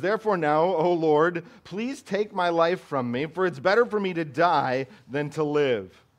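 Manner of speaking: loud, high preaching of Jonah's plea; preaching at full force. Acted. Preacher thundering Jonah's death wish to the congregation, loud and high. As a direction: cry it out from the pulpit.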